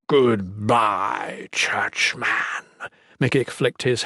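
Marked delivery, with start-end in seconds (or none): 0.1-2.7 mock farewell in monster voice; taunting monster villain. A narrator's reading. Monster striking down the churchman, taunting. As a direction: send him off with menace.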